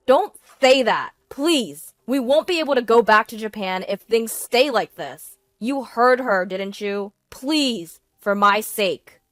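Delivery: loud, urgent begging; desperate pleading. A narrator's reading. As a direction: beg her to help you get home. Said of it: "you've never pleaded like that".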